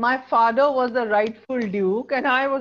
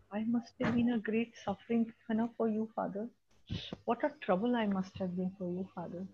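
soft sad Miranda; 2 semitones higher, 9 dB quieter than usual. Retold or imitated. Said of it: "She says".

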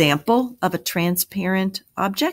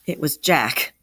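plain reading voice

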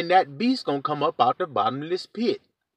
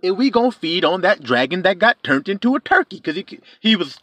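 ordinary talk

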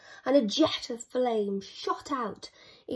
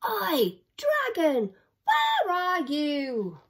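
higher calling shout, slow ending; shouting together; 6 semitones higher, slower than usual. Acted. Vikings calling for the dragon, shouting. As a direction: call out across the hill. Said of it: "they shouted all together".

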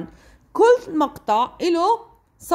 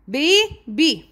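put-on baby in two beats, ba, by; split into syllables. Shown as a demonstration. Teacher listing two-syllable words, baby split in two. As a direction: pause between the halves of baby. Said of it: "Two syllables".

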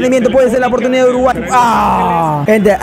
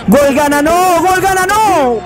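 excited cry; 7 semitones higher, slower than usual. Real, not acted. Goal chance in match.

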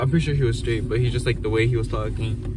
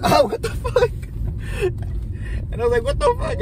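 very high mock squeal of disbelief; shocked outcry. Acts out reaction to being hit.

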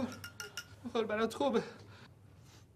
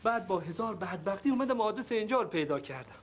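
ordinary delivery

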